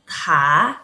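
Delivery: "ta" rising from low; low start on "ta", climbing to the end; second tone drill. Shown as a demonstration. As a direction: sweep "ta" upward, aspirated. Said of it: "Sekali lagi".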